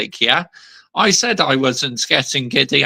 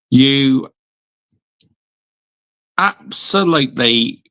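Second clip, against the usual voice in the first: slow, broken into chunks as he types; typing aloud; 2 semitones lower, much slower than usual. Real, not acted. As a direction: drag each word out while typing. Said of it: "I'm gonna actually post a comment".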